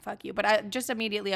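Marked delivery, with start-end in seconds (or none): 0.0-0.3 fuck you said much softer and smaller; a muted fuck you, not the angry one. Shown as a demonstration. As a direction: drop it to a near mutter. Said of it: "But, like, at the same time, not".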